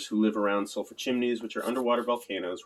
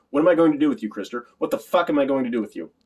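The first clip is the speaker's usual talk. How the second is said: loud, exasperated father's outburst; angry outburst; 5 semitones higher, 8 dB louder than usual. A narrator's reading. Father at his wits' end with Christopher, loud and angry. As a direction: raise the voice, higher and louder, at the end of your patience.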